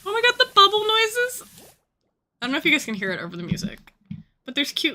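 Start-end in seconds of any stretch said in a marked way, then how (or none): 0.0-1.4 high, loud delighted cry; excited squeal. Real, not acted. Streamer hearing bubble noises, excited.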